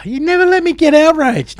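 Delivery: high, indignant whine; playful indignant whine; mock complaint. Real, not acted. Playful argument with co-host.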